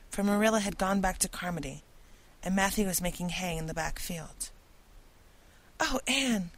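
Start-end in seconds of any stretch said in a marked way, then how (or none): 5.8-6.5 high gasping cry of relief; gasped. A narrator's reading. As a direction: gasp it out, high and breathless. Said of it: "gasped Diana".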